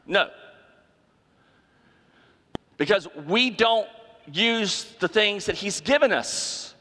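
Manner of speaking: normal talking voice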